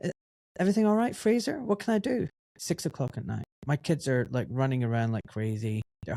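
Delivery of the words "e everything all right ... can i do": high, obliging phone greeting; helpful reply in a raised voice; retold friendly answer. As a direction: re-enact picking up the call, pitched well up. Retold friendly answer to director's call.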